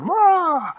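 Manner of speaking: very high, very loud roar; the biggest roar of all; monster roar. Acted. Jolly Green Giant ripping off the house, huge roar.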